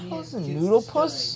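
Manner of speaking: high, slow, puzzled falling read of "noodle puss"; puzzled mock insult. Shown as a demonstration. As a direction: say "How's a noodle puss?" slowly, high, landing down on "puss". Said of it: "Is that the way these Bersting Bears swear".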